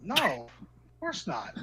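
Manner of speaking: slow, drawn-out denial; mock-innocent, stretched-out answer; feigned innocence. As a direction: deny it slowly as if hiding something.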